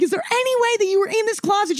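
high fast yell asking mom about the closet; screaming at her. Retold or imitated. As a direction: fire it at her high and panicked. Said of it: "I'm, like, screaming at her".

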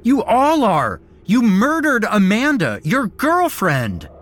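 high, loud furious accusation; enraged scream. A narrator's reading. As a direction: hurl the murder at him. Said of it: "I screamed at him".

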